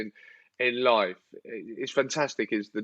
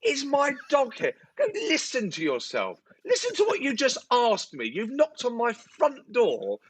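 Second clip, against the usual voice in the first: incredulous rant; 10 semitones higher than usual. Real, not acted. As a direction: rail at how absurd it is. Outraged at neighbour's question.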